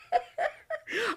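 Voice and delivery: high, soft fit of laughter; helpless laughter. Real, not acted. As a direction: crack up when you shouldn't. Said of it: "I shouldn't laugh at this".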